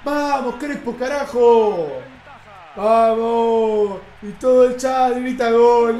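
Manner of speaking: loud, long-drawn goal yell; goal celebration shout. Real, not acted. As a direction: roar the goal, stretching the cheers. Celebra otro gol de Crespo. (festejo de gol a grito pelado)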